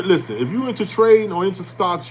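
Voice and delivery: normal talking voice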